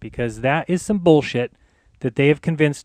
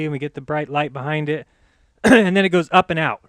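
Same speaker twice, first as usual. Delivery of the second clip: normal talking voice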